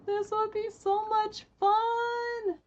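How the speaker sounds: very high, loud, delighted squeal; real joy, voice shooting up; squealing excitement. Real, not acted. Thrilled at a cat-feeding quest.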